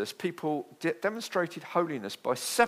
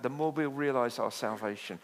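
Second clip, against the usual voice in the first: ordinary delivery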